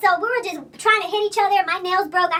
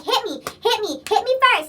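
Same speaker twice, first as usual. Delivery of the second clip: higher-pitched mock challenge; goading dare; 5 semitones higher than usual. Storyteller imitates her rival squaring up before the fight, goading dare. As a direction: egg them on in a mimicking voice.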